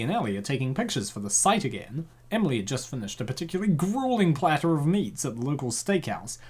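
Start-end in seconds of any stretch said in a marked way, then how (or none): none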